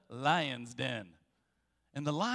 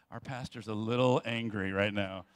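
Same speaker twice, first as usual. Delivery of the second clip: mock congregation thought; 10 semitones lower than usual. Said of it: "You're like".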